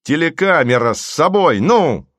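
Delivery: громко и выше обычного, требовательно; поторопи его криком; нетерпеливый приказ. A narrator's reading. Голубович криком требует камеру. (громкий нетерпеливый окрик)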